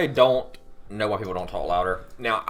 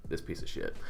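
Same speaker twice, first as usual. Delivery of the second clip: whispered; 11 dB quieter than usual. Real, not acted. Self-deprecating aside.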